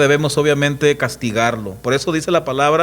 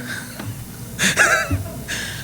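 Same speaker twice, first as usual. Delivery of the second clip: breathy laugh; 6 dB quieter than usual. Real, not acted. Laughs after mocking father.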